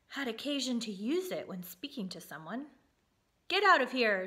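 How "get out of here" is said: loud furious command; shouted. A narrator's reading. King driving the mouse away, shouting. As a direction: roar him out of the room. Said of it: "shouted the king".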